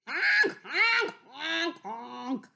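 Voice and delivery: high, loud honking cries; goose honks. Acted. Missus Goose honks in alarm at the wolf. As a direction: honk in alarm like a frightened goose. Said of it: "cried Missus Goose".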